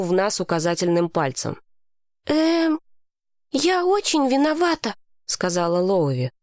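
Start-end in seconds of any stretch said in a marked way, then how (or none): 2.3-4.9 put-on higher girl voice, a touch of tears; tearful girl. A narrator's reading. Lowie apologizing to Mason, high and a little tearful. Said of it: "В глазах у неё стояли слёзы".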